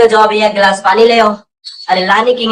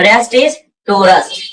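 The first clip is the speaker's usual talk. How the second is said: slow dictation; much slower than usual. Shown as a demonstration. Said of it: "क्या बोलेंगे?".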